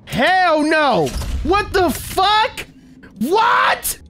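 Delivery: high drawn-out what the fuck; stunned disbelief. Real, not acted. Streamer shocked by a game moment, high stunned outcry. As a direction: shriek it in shock.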